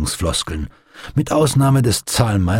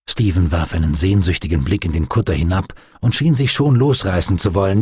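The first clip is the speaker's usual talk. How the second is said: ordinary delivery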